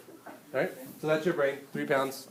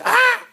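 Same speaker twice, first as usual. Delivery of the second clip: loud, much higher mock scream; mock scream of fright; 14 dB louder than usual. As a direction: shriek as if ambushed in the woods. Someone jumps out of woods.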